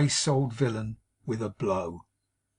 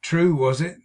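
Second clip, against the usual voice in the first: dogged; 4 semitones higher, 7 dB louder than usual. A narrator's reading. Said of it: "said Jonas doggedly".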